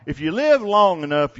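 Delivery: ordinary delivery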